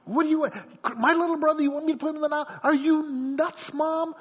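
put-on high-pitched, outraged kid protesting to mom; hammed-up youngster who thinks mom is crazy; incredulous daughter. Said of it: "And then she says".

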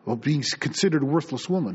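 usual speaking voice

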